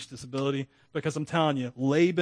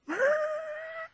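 high-pitched laugh. Real, not acted. High laugh after story.

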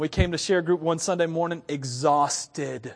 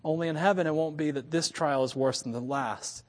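ordinary talk, nothing marked

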